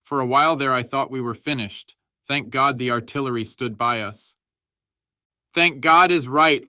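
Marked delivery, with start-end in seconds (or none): 5.5-6.7 high, loud, slow line through a mock laugh; the grenadier answers the cheer, laughing loud and high. A narrator's reading. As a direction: toss it back with a relieved chuckle. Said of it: "laughed the grenadier".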